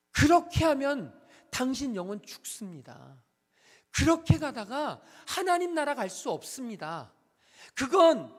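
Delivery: from slow and firm to a full shout; preaching shout. Acted. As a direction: cry out the warning over them. Preaching climax.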